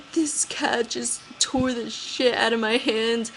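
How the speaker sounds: high, slow tearful complaint; crying. Retold or imitated. To Ryan on the phone after the cat attack, tearful. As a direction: sob it out to your boyfriend. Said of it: "bawling my eyes out".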